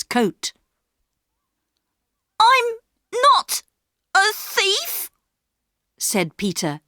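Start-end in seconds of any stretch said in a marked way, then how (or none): 2.4-5.1 much higher, wavering denial; unsure boy. A narrator's reading. Peter caught stealing coal, denying it unsure. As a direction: protest without conviction. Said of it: "did not sound very sure".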